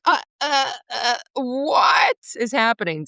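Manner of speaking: slow, halting, half-crying stammer in a put-on voice; hammed-up bewilderment, as if near tears; mock overwhelmed whimper. Retelling finding out her song was a cover, mock tearful stammer.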